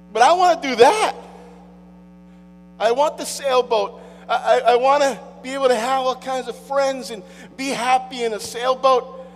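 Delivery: hammed-up craving; mock longing. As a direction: whine it like a wanting kid.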